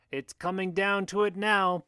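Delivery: high-pitched booming ringside announcer. A narrator's reading. Booker announcing the climax of the fight, booming. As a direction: call it out like an arena announcer. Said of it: "Booker's voice booms out".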